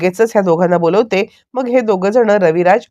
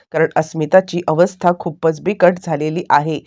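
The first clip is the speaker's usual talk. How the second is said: normal talking voice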